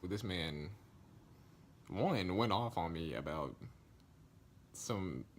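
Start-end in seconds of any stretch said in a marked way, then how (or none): none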